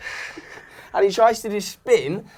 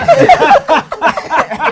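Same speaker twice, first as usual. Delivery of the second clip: burst of laughter; 11 semitones higher, 9 dB louder than usual. Real, not acted. Laugh at retold dad.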